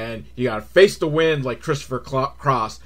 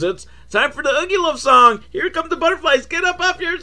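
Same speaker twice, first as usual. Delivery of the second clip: mock kids-show host; 14 semitones higher, 3 dB louder than usual.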